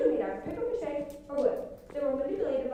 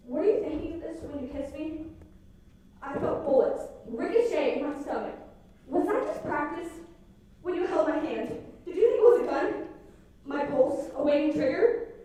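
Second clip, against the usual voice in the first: spoken-word poem; 5 dB louder than usual. Acted. Girl speaking to her shooter boyfriend in a spoken-word poem. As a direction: perform it like a slam poem.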